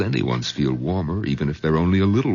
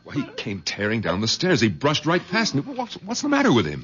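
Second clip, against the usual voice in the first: shaken; 8 semitones higher than usual. Acted. Husband shaken after father rushed by.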